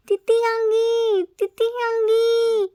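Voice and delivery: high, loud, slow tearful shouts; crying and shouting. A narrator's reading. Scared child shouting for his Titi Hangi, crying. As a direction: shout his lungs out, sobbing. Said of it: "Started crying and shouting".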